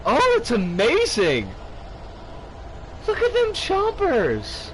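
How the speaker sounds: loud, high delighted squeal; excited. Real, not acted. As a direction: gush over the toothy fish. Delighted at fish teeth.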